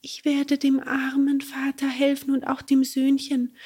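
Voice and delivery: high unvoiced whisper; gentle whisper. Cricket promises to help father and son, whispering.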